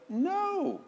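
high, drawn-out "no"; high-voiced retold protest; acted reply. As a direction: answer with a long, high wail of no. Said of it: "And the guy goes".

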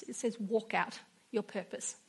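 Walk out your purpose whispered softly, walk stressed; whispered gentle correction of the misread word. Shown as a demonstration. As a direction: hush it, lean on walk. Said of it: "I didn't type it wrong".

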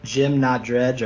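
ordinary talk, nothing marked

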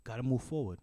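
rough imitation of a Mexican accent; offhand advice in a loosely done Latin accent. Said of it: "I can't do the accent very well".

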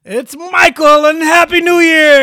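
booming slow hyped intro; drawn-out big announcer welcome. Acted.

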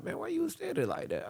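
high, quick teasing question; high mocking voice. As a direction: tease him in a high voice. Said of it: "They was like".

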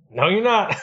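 defiant. Retold or imitated. Defiant retort to the computer.

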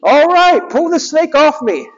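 high cocky hero; high smug hedgehog hero; Sonic's voice. Retold or imitated. Sonic replies.

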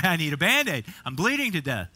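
loud, hammed-up kid complaint; mock child's yell. Imitating a fallen boy pleading for a bandage, mock yelling. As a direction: wail it like an overdramatic hurt child. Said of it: "He began to yell".

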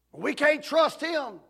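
high, loud, slow protest; mock indignant cry. Church ladies refusing to forgive him, indignant and loud. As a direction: throw it out in self-righteous outrage.

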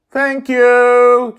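very high, loud call; yelling thanks. Acted. Joy yelling thanks to the dreamer while speeding away. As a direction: shout thanks over the wind. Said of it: "Joy yells".

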